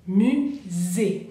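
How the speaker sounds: "musée" slowly, the s buzzed as z; careful French "musée" with a z sound; French pronunciation model. Shown as a demonstration. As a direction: model "musée" as mu-zé, syllables apart. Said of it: "la S es como una Z".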